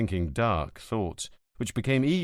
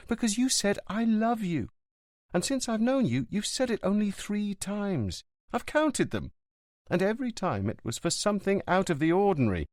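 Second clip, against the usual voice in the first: put-on girlfriend's voice; 10 semitones higher than usual. A narrator's reading. Livia on the phone to Montalbano, apologetic. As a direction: make up gently with him.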